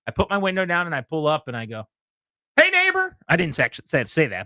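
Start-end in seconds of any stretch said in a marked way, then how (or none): 2.6-3.2 high, cheery Ned Flanders greeting; a high, sunny hey neighbor. Retold or imitated. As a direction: chirp it like the friendly neighbor. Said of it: "You Ned Flanders".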